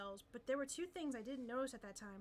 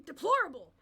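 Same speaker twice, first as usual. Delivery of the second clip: indignant; 3 semitones higher, 13 dB louder than usual. Acted. Protester's indignant outburst.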